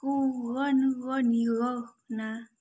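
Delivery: "ron, roni" swung from the throat; swinging recitation. Shown as a demonstration. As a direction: let each syllable ring. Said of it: "gabungkan dengan ayun-ayun".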